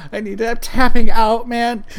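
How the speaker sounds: loud exasperated give-up. Real, not acted. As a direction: blurt it out, spent. Gives up amid laughter.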